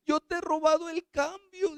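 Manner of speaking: put-on a nine-year-old crying out to her mother; crying little girl. Girl sobbing to her mother over her sins, high and pleading. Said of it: "esa niña llorando decía".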